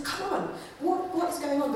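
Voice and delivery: high, incredulous come on; exasperated plea. Retold or imitated. God demanding answers from Israel, high and exasperated. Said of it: "God is genuinely, like, saying".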